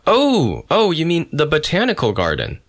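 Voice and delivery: high, bright oh of an enlightened janitor; sudden realisation. Acted.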